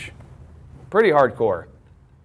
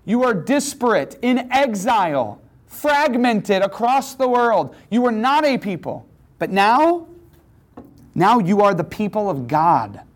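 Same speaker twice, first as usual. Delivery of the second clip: raised, emphatic preaching voice; 7 semitones higher than usual. Acted. Pastor preaching identity to a class, emphatic. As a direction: preach it to the room with conviction.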